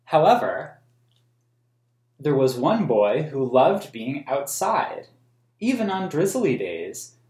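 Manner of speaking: put-on reading a picture book aloud to children; storybook reading for kids; story narration. A narrator's reading. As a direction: tell the story in a clear, even read-aloud voice. Reads the book's text aloud.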